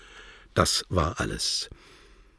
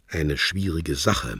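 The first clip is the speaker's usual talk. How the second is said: ordinary delivery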